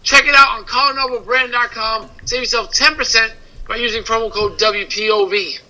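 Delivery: sponsor read. Acted. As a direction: read the promo like an ad spot.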